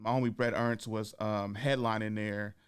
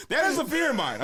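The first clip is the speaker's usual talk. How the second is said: laughing; 13 semitones higher, 6 dB louder than usual. Real, not acted. Laughs while admitting fear.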